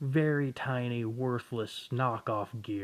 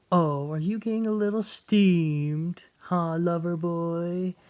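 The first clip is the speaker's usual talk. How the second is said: high, slow teasing sneer; mocking taunt; 6 semitones higher, 6 dB louder than usual. Rainier teasing the furious boy, mocking and high. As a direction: purr it out to goad him. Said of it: "villain taunting".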